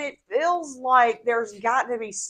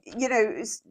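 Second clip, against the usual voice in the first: ordinary talk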